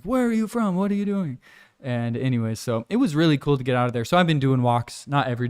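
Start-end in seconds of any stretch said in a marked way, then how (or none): 0.0-1.4 higher-pitched mock puzzled questioning; curious drivers imitation. Delivery drivers questioning the foreigner, high curious imitation. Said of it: "they're like".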